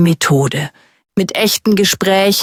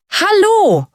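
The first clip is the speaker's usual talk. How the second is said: bright greeting; 11 semitones higher than usual. Retold or imitated. Quoted greeting in learner story.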